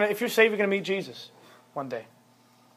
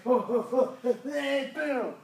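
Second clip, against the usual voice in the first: mock angry kid; 6 semitones higher, 6 dB quieter, slower than usual. And he's like.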